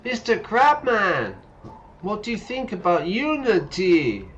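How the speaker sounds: loud high calling out; yelling up. Pretend. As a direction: shout it up to the tower. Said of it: "yell up at the tower".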